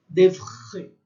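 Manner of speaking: D'oeuvre, loud, with the R scraped in the throat. Shown as a demonstration. Teacher shows how to scrape the throat, emphatic guttural R. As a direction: rasp the French R at the end of D'oeuvre. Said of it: "raspa a garganta".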